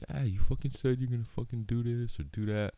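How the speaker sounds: high, mocking scold voice; imitating a friend holding you to your word; mock nag. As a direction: whine it like a nagging friend calling you out. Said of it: "I don't have anybody being like".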